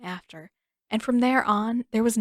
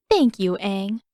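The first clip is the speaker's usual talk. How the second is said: prim; 4 dB louder, slower than usual. A narrator's reading. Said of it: "Katara said primly".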